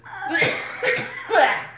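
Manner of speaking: high falling cackle; a hammed-up giggle fit; character laugh. [name] laughs at his own wheelbarrow joke, high cackle.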